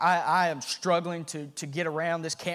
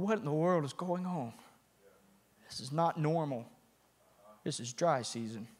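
hushed wonder; 3 semitones lower, 10 dB quieter than usual. Retold or imitated. Hearing strange noise at night.

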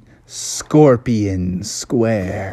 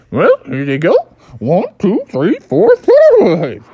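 higher, loudening cartoon creature count; Mandy the Unicorn; 7 semitones higher, 5 dB louder than usual. Unicorn pays Bubby for pizza, high showy counting. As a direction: count it out high, building to loud.

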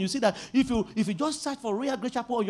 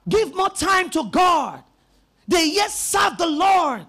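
slow, loud preaching shout; fervent preaching; 6 semitones higher, 6 dB louder, slower than usual. Acted. Preacher exhorting churchgoers, slow full-voice shout. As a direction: drive it home to the congregation.

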